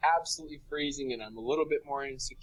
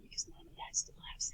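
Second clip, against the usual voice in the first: whispered aside; 12 dB quieter than usual. Real, not acted. Embarrassing aside to listeners.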